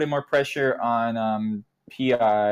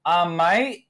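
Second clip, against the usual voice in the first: a mock Australian kid, pitched up; 6 semitones higher, 3 dB louder than usual. Kid reporting to the town in mock Australian. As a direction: put on an Aussie twang for the mate. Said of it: "they had this Australian kid".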